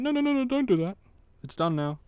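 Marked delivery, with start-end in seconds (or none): 0.0-1.0 high fussy voice scolding a mistake; mock nagging scold. Players who would object, mockingly imitated, high fussy nagging. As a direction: mimic an imaginary nag yelling no. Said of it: "No one can be like".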